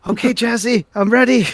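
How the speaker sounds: high, loud announcement; calling out. Acted. Doc back from the hall calling to Jazzy, loud.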